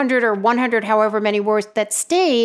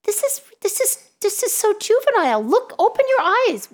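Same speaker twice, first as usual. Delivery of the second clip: exasperated; 11 semitones higher than usual. Retold or imitated. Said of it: "I'm like".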